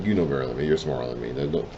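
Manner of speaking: usual speaking voice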